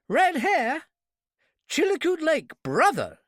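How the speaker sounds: high, loud eureka exclamation; excited realization. Acted. Clerk realizes who the driver was and rushes off. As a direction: it clicks all at once.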